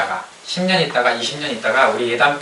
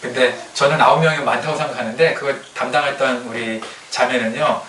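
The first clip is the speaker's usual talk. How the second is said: ordinary talk, nothing marked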